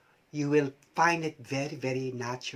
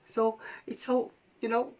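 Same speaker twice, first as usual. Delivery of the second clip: usual speaking voice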